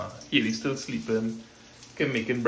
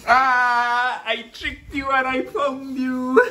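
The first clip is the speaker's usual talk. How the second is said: excited gotcha; 12 semitones higher, 5 dB louder, slower than usual. Pretend. Finds the hiding child.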